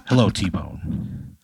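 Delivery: slow hushed greeting; a detective whispering to his contact; cool whisper. Acted. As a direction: breathe his name, unhurried and secretive. Player greets fence as cool detective.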